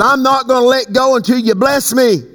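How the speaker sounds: high, insistent vow; fervent preaching. Real, not acted. Preacher vowing to hold on for blessing, fervent preaching. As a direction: lift it into a high preaching cry.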